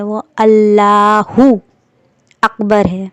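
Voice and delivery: اللہ اکبر drawn out slowly and clearly; careful slow Allahu Akbar. Shown as a demonstration. Teacher models the correct word, slow. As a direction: pronounce اللہ اکبر deliberately. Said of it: "جو صحیح word ہے".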